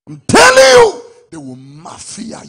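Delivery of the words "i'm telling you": screamed, very high and loud; shouted insistence. Real, not acted. Preacher screaming his point at the congregation. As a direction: hit it with everything. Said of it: "preacher insists at full force".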